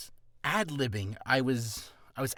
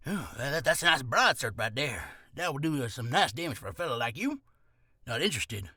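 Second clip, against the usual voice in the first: gruff shop merchant; 2 semitones lower than usual. Acted. Shopkeeper pushing a broadsword on a customer, gruff. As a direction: play a gruff fantasy merchant hawking wares. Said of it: "you can be a gruff be".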